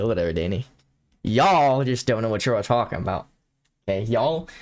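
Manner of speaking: usual speaking voice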